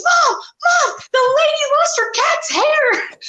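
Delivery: very high mock child's wail; freaked-out kid. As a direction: imitate a panicked child crying out for Mom. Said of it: "this kid's freaking out at this point".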